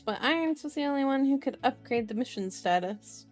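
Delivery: ordinary delivery, nothing marked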